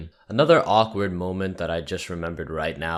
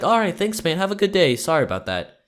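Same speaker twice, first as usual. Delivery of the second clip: much higher and louder chipper goodbye; switched-on cheery customer; 11 semitones higher, 5 dB louder than usual. Retold or imitated. Customer cheerfully thanking the drive-thru worker. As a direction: beam a fake friendly farewell. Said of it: "he just switched personalities".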